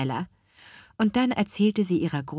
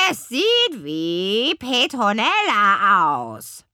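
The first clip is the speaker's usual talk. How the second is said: slow, rattling raven voice, higher and louder; rasping raven; 4 semitones higher, 6 dB louder, slower than usual. A narrator's reading. Raven tells grandmother who is calling, rasping. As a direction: rasp it like a bird. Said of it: "schnarrte der Rabe".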